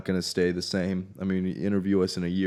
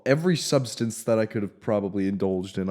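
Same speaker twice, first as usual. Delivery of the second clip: usual speaking voice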